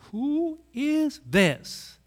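awed wonder. Acted. Disciples awed after storm stops.

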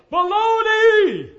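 long-drawn, high yell into a canyon. Acted. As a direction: holler it out at full volume, stretching the vowels. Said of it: "You can yell things out".

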